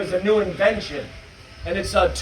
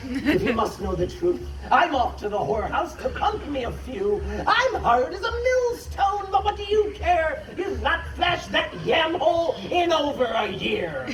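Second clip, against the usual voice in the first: high-pitched ranting verse; exasperated father's outburst; 8 semitones higher than usual. Acted. Father confessing to his wife in the poem, exasperated rant. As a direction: let the father's confession climb higher in rhyme.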